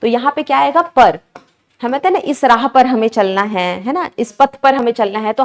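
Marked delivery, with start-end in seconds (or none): none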